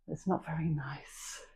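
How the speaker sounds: quiet joking whisper to the class; breathy confiding aside. Real, not acted. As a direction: drop to a whisper for the joke. Joke about hitting own face.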